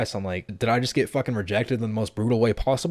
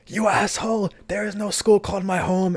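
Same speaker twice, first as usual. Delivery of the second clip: much higher, louder yell from a distance; mock angry yell; 11 semitones higher, 4 dB louder than usual. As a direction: imitate her shouting after them. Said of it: "And then I hear".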